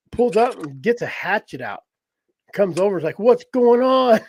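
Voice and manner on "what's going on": high, loud, slow mock hillbilly woman; drawn-out challenge, pitched high and loud; hillbilly chick. Man imitating hatchet-wielding woman demanding answers, mock hillbilly.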